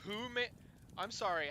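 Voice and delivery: normal talking voice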